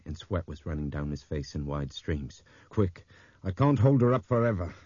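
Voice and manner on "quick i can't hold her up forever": loud, breathless and urgent; panting, straining plea; out of breath. A narrator's reading. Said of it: "He was panting".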